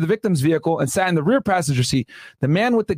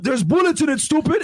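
mocking gunman; 8 semitones higher than usual.

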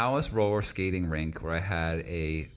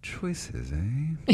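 put-on skeptical kid; 5 semitones higher, 4 dB quieter than usual. Skeptical child reply.

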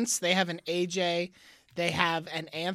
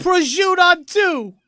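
put-on Jersey accent; 11 semitones higher, 12 dB louder than usual. Mock Jersey huddle call.

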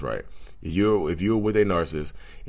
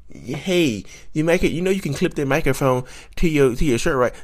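high, mocking voice of a nitpicking commenter; 9 semitones higher than usual. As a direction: mimic the commenter in a high, snide voice. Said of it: "That's stupid".

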